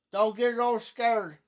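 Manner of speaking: ordinary talk, nothing marked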